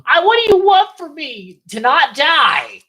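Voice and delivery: high, hammed-up protest; mock exasperation. Imitating Tina arguing with Loomis, high mock exasperation.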